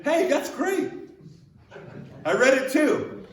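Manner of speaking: high, chirpy mock reply; mock cheerful reaction. Preacher mocking a fake churchgoer's praise, high and chirpy. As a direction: put on a bright fake-enthusiastic voice.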